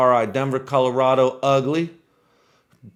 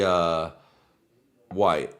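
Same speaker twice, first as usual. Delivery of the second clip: ordinary talk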